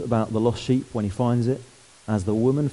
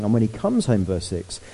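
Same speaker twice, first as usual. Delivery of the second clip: ordinary talk, nothing marked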